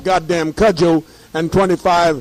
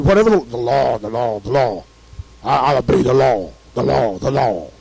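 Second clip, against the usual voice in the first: lower, droning mock of a dutiful citizen; mocking obedience; 6 semitones lower than usual. Mocking Americans who always obey, in a lower droning voice. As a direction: parrot the law like a sheep.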